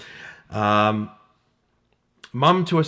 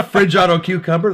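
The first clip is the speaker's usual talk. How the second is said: higher, louder exasperated repeat; rising frustration; 6 semitones higher, 6 dB louder than usual. Acted. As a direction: blurt it in mounting disbelief at autocorrect. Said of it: "This is how frustrating it is".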